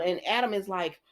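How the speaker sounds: usual speaking voice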